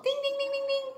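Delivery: high ringing ding-ding like a little bell. Pretend. Teacher playing the bell for the class, high ringing dings. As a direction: chime it out like a bell.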